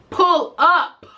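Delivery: loud defiant "Pull up"; defiant dare. Retold or imitated. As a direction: throw down the challenge. Daring the woman to come over.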